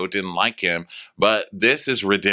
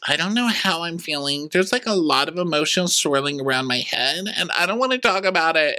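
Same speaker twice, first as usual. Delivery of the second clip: tearful; 8 semitones higher, faster than usual. Retold or imitated. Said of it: "And now she's crying".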